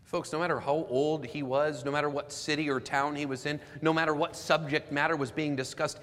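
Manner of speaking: preacher driving the point home; preaching building to climax. Real, not acted.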